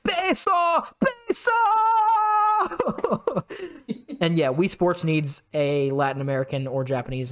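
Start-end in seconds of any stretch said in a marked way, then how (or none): 0.1-1.5 much higher, shouted cheer; an announcer on Mexican radio shouting the word; excited announcer call. Retold or imitated. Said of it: "the announcer". 1.5-4.2 laugh. Real, not acted. Laughing after the announcer bit.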